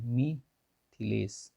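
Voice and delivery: मिथिलेश drawn out very slowly, each syllable separate; slow spelled-out word. Shown as a demonstration. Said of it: "मिथिलेश होता है".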